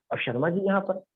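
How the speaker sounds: ordinary talk, nothing marked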